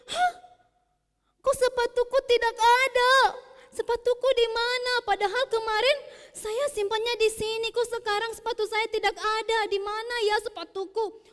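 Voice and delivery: put-on high, loud startled child; surprised. A narrator's reading. Mono finds his new shoes gone, alarmed. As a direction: panic at the missing shoes. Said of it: "Terus Mono kaget".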